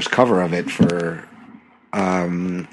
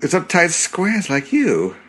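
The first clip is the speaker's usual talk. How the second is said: quoted movie line; 7 semitones higher, slower than usual. Retold or imitated. Quoting Austin Powers.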